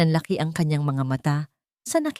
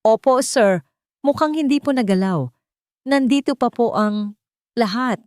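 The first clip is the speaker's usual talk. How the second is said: higher, eager-to-assure officer; respectful, pitched up, a touch anxious; polite but nervous; 6 semitones higher, 4 dB louder than usual. A narrator's reading. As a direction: report nervously to an important man. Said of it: "magalang ngunit kinakabahang boses".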